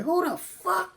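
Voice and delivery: high, startled yelp of disbelief; shocked outburst. Retold or imitated. To a login alert from New Zealand, a high shocked yelp. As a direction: jump up in pitch like you just got robbed.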